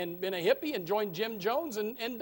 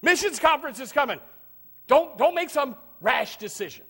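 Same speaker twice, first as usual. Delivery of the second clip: higher, louder scolding relative; a preacher's mocking relative voice, raised; mock cautious relative; 7 semitones higher, 8 dB louder than usual. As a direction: nag them to hold back.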